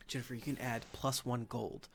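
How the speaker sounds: softly, like slipping money; hushed secret aside; secretive npc aside. Acted. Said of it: "She's like".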